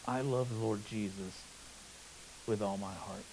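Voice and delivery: hushed and soft, nearly breaking; soft trembling voice. Retold or imitated. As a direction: whisper it with awe. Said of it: "with a trembling voice".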